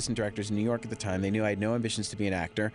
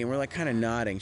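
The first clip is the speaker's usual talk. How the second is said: usual speaking voice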